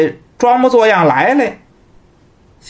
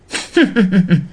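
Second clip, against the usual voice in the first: a short, cold scornful laugh through the nose; cold sneer laugh. Acted. Fortune-teller sneers coldly at the confessing thief. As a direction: sneer at the servant who just gave himself away. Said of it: "是一阵的冷笑".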